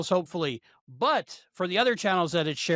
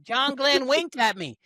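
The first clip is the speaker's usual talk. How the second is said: higher, slow Southern twang; Southern accent; 8 semitones higher, 3 dB louder, slower than usual. Pretend. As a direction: drawl it out like a starstruck belle. Playful mock Southern astronaut bit.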